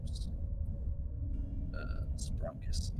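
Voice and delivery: unvoiced whisper. Real, not acted. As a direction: breathe it, no voice. Whispered aside at the microphone.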